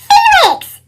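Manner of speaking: high sharp yell of his name. A narrator's reading. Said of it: "yelled his mom".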